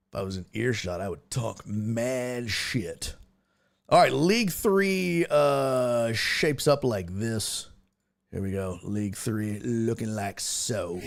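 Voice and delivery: ordinary delivery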